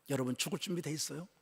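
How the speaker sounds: soft searching question to the church. Acted. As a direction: ask it quietly, almost privately. Sermon question asked twice.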